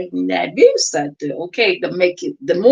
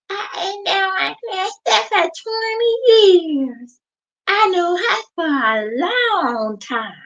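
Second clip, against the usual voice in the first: high, slow mocking imitation; high drawn-out mock voice; mimicking the lady; 12 semitones higher, slower than usual. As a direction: put on her voice to mock her. Imitating the purple lady's claim.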